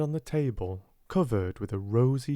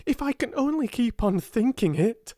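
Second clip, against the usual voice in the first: high, urgent outburst; excited cry; 11 semitones higher, 4 dB louder than usual. A narrator's reading. Girl clinging to her dream, excited cry. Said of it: "she cried".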